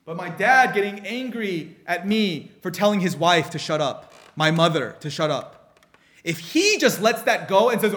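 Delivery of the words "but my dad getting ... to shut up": usual speaking voice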